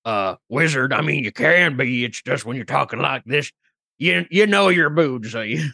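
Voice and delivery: folksy country accent, unhurried. Shown as a demonstration. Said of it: "You don't talk like this if you're a highfalutin".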